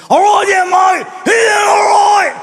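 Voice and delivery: high, loud, slurred raspy drawl; like a drunk pirate underwater, stretched out slowly; raspy drunk Aussie. Retold or imitated. Drunk Aussie greets the comedian at the pub, loud raspy slur. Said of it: "He had more of a raspy voice".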